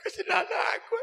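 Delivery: high wailing cry; mother crying. Retold or imitated. Mother reading her son's letter, crying out high. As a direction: wail it like a weeping mother. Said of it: "Ibu pasti nangis".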